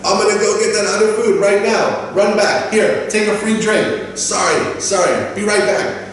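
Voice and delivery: high, hurried, helpful waiter; eager apologetic waiter. Acted. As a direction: play staff rushing to fix it. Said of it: "they should be like".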